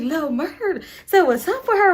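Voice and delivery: high, loud squeal of gossip; excited gossip. Retold or imitated. Friends telling Simone a boy likes her, excited. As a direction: friends passing on juicy news.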